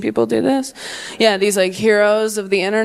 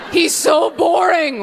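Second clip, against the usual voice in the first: wailing complaint; 7 semitones higher, slower than usual. Retold or imitated. Bride's backwards confession.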